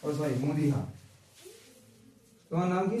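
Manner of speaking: ordinary talk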